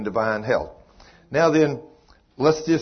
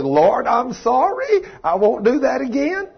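mock contrite apology; 11 semitones higher, 5 dB louder than usual. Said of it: "And I said".

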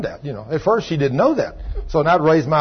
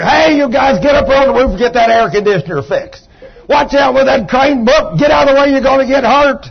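loud, high-pitched foreman's yell; job-site shout; 8 semitones higher, 6 dB louder than usual. Acted. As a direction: holler orders over running machinery. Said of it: "and you scream at her".

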